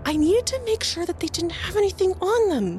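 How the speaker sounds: bossy leader telling the nervous one to chill, irritated; irritated bossy cultist. Acted. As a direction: shut your jittery partner down. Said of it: "a really tense, harsh whisper between them".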